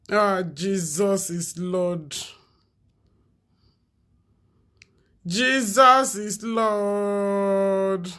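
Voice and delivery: slow, soft, drawn-out "Jesus is Lord"; weary disbelief at the video, slowly and softly; exasperated plea. Real, not acted. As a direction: groan it out, begging for patience.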